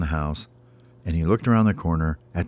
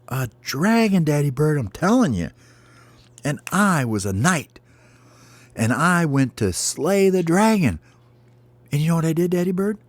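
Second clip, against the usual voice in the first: high-pitched little bird voice; high, lilting storybook chick; eager baby bird; 8 semitones higher than usual. Acted. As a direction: insist like a child telling a parent a big dream. Plays baby bird telling dream.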